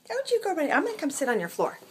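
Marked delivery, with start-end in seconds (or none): 0.1-0.7 much higher, soft coaxing pet voice; baby talk to the cat. Acted.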